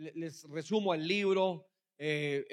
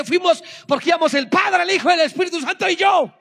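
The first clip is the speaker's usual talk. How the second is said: loud, high and fast; triumphant retold boast; proud excited boast; 8 semitones higher, 11 dB louder than usual. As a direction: announce it loudly with pride. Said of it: "Yo dije".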